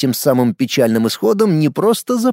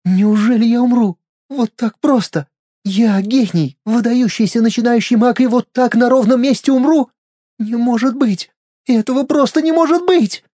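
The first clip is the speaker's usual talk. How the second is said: panicked disbelief; 9 semitones higher than usual. Acted. Dying princess disbelief.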